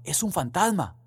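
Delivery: discípulos gritan con miedo; frightened exclamation. Acted. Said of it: "se asustaron y exclamaron". (exclamación asustada, más aguda)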